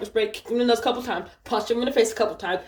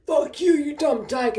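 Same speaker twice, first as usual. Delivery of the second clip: high, slow imitation of his cursing; mocking beaten man; 7 semitones higher, 3 dB louder than usual. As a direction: drag out his defeated insult up high.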